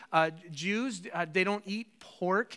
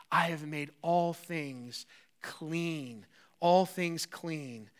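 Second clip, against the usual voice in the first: very slow, lower, drawn out; solemn declaration; 4 semitones lower, 4 dB quieter, much slower than usual. Acted. God declaring everything clean, very slow and low. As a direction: pronounce it like a final decree. Preacher voices God's declaration.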